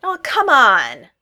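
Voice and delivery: loud, slow, drawn-out protest; exasperated yell. A narrator's reading. Josh flinging his arms up at Sam's jab, exasperated yell. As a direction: throw it up to the ceiling in disbelief. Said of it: "Josh yelled".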